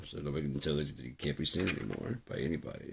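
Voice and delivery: usual speaking voice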